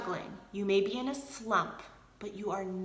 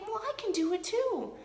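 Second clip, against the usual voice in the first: team member inspired; 13 semitones higher than usual. Retold or imitated. Voicing the team's thought.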